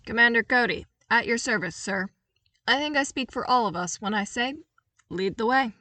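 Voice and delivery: crisp military reply; proud soldier at attention; clone commander. Acted. Trooper commander voiced.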